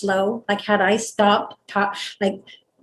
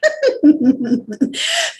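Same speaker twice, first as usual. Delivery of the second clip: higher-pitched burst of laughter; real amused laugh; 7 semitones higher, 4 dB louder than usual. Real, not acted. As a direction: crack up at your own question. Joking about being out of body.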